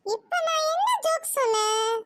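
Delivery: puzzled, pitched well up; baffled question. Acted. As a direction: ask it in rising bewilderment. Baffled aunt questions the laughing girl.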